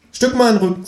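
very high, squeaky-pitched kangaroo; kangaroo character voice. A narrator's reading. Kangaroo asking narrator to shift over, high cartoon voice. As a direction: play a cheeky talking kangaroo. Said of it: "sagt das Känguru".

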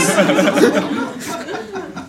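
high, odd cackling laugh; kangaroo cackle. Acted. Kangaroo laughs at the narrator dangling from the rope, high cackle.

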